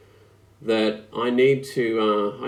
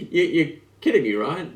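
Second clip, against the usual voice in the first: fast, louder, laughing; laughing disbelief; 7 semitones higher, 5 dB louder than usual. Retold or imitated. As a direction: scoff it through laughter. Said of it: "you go".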